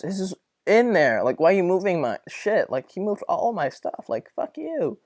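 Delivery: indignant rant. Retold or imitated. Retold anger at roommate.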